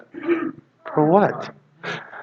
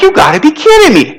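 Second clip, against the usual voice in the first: exasperated; 13 semitones higher than usual. Retold or imitated. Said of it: "I'm like".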